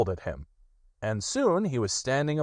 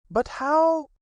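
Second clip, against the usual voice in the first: high, pleased rabbit voice; high self-satisfied Rabbit; 4 dB louder than usual. Acted. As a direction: Rabbit taking credit, high. Rabbit asks.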